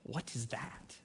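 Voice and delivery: hushed mimicry of the little boy's question; son's question voice. Preacher prompting neighbours to ask, in his son's hushed weird voice. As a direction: drop right down soft, like the puzzled child.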